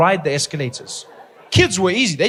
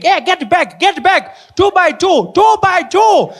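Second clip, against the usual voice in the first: much higher, louder frantic calling; panicking teacher; 11 semitones higher, 7 dB louder, slower than usual. Retold or imitated. Teacher losing control of the class, shouting in panic. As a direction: shout over a crowd of wild kids. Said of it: "She's panicking, like".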